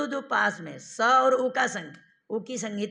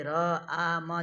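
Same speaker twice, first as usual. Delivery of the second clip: र, आ said slowly as separate sounds; sounds pulled apart; 3 semitones lower, much slower than usual. Shown as a demonstration. Teacher slowing राम into its sounds for students. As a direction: stretch राम into र, आ, म. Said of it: "जितने जल्दी बोल रहे हो ना".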